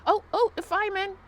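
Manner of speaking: high, loud thrilled cry; excited shout. Real, not acted. Spotting the firemen from the balcony, shouting excitedly. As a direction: call out in delight at the firemen.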